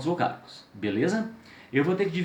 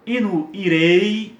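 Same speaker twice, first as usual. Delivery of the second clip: 'irei' slow, loud, leaning on the 'e'; teacher showing the falling diphthong; 3 semitones higher, 6 dB louder, much slower than usual. Shown as a demonstration. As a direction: press the 'e' of 'irei', then let the 'i' fade. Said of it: "ele decresceu".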